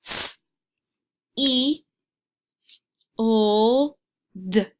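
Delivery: each letter said on its own, with pauses; sounding out letters. Shown as a demonstration. Teacher spelling a word for young learners, letter by letter. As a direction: spell F, E, L, D out slowly, one by one. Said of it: "I chose f in the beginning".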